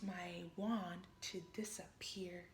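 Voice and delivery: soft breathy whisper, as if vanishing. Pretend. Little fairy whispers about vanishing with her wand. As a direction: let the words disappear into a whisper.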